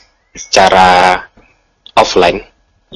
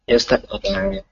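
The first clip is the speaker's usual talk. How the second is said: choppy call, words cutting out; distant broken-up phone voice; breaking up space radio; 3 semitones higher, 12 dB quieter than usual. Real, not acted. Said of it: "suaranya terputus".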